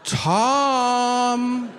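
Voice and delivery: high calling voice, laughing; mock cries of Tom. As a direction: call his name like a hurt wife. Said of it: "she starts calling me out by name".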